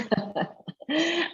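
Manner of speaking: short bright laugh, pitched high; an amused little laugh before answering; real laugh. Real, not acted. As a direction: laugh at the question. Amused by the question.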